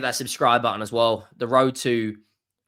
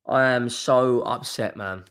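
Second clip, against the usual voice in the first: ordinary talk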